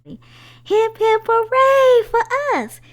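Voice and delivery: very high, very loud hooray; gleeful cheer. A narrator's reading. Twin brother joins the celebration, cheering loudly. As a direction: shout it out like a party chant. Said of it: "Harold chimed in".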